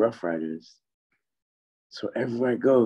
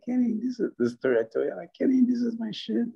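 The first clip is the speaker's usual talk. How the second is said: eager pitch; 14 semitones higher than usual. Retold or imitated. Own pitch retold.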